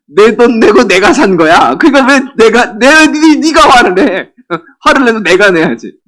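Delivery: high, loud comic outrage; indignant retort. Acted. Woman answering those angry at her spending, indignantly. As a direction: protest hotly as the wronged spender.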